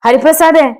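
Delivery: high, anxious call of a name; loud call. A narrator's reading. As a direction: call out for your missing companion. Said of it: "Thampi ഉറക്കെ വിളിച്ചു".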